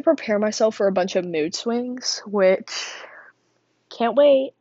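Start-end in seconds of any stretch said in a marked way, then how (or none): 3.9-4.5 loud sarcastic high mock. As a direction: overdo the fake excitement. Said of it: "That was extremely obnoxious".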